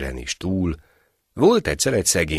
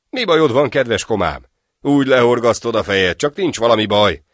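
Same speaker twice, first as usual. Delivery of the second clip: higher, louder worried question; loud concern; 6 semitones higher, 7 dB louder than usual. Acted. Friend calls to the sad soldier, loud and concerned. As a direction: call out to the downcast friend.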